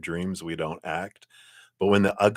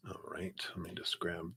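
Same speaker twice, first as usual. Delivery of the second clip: whispering; 18 dB quieter than usual. Real, not acted. Muttering while taking screenshots.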